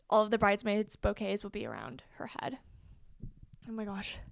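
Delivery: winded, running out of air; out of breath. Real, not acted. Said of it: "I'm, like, out of breath".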